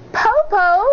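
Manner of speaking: put-on high little girl calling; high child voice; child's voice. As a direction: call like a small child. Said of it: "she said".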